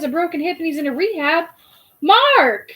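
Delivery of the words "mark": high loud startled Mark!; shocked cry. Real, not acted. Learning a friend broke his hip, crying out loud. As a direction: call his name in shock.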